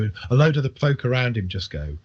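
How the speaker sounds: usual speaking voice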